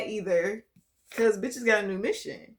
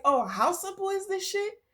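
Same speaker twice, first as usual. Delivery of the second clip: amazed realisation; 11 semitones higher than usual. Retold or imitated. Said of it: "It's like".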